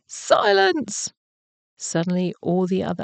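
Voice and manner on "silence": very high, drawn-out call for order; mock full-voiced shout. A narrator's reading. Horatio silences the noisy animals, shouting in storybook style. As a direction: call out high to hush a crowd. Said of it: "shouted at the top of his voice".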